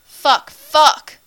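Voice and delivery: loud, high repeated outburst; spat curse. A narrator's reading. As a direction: spit it out twice, overwhelmed. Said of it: "he spits".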